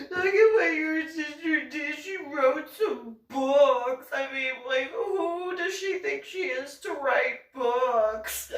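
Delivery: high, whiny mock outrage, slowing down; a sneering high imitation of a sister-in-law; mocking [name]. [name] showing Zach and Patricia the books, high mocking whine. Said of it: "and be like".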